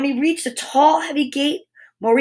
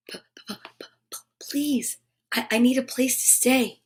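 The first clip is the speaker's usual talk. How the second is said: hushed, stuttering plea; softer, stammering frightened father; stammering fright; 7 dB quieter than usual. Acted. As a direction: beg for shelter while shaking with fear. Terrified Maurice meets the beast.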